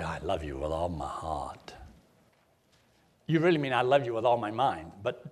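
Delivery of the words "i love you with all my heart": tender. Shown as a demonstration. Saying I love you.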